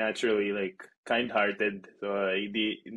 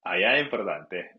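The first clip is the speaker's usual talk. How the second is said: ordinary talk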